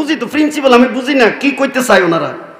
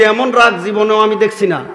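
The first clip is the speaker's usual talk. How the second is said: ordinary talk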